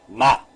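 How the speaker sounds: "ma" repeated as one open syllable ending in A; syllable reading. Shown as a demonstration. Teacher repeats the syllable for learners, "ma" set apart clearly. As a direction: sound out "ma" on its own. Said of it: "Veja bem".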